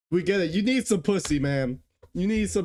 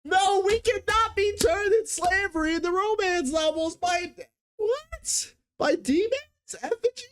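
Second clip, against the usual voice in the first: outraged disbelief; 13 semitones higher than usual. Real, not acted. Reacting to the demon book summary, outraged and yelling. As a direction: shout it out in disbelief.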